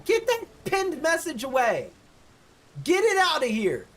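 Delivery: high-pitched, indignant yell. Real, not acted. Streamer yelling at a chatter over a pinned message, yelling. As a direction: yell it like an order. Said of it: "as I yell at Dankus Rick".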